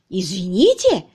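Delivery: loud, incredulous echo of an apology; scornful, emphatic question; indignant echo. Acted. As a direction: throw the word back in outrage. Chief throws the apology back.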